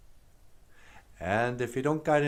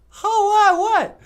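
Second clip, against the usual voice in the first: very high, loud surprised squeal; high amazed puppet what; puppet astonishment; 12 dB louder than usual. Acted. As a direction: gasp it out in delight.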